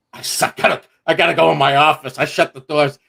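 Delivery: in a hurry to hide the laughter; laughing. Retold or imitated. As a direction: blurt it out fast through rising laughter. Retells fleeing meeting laughing.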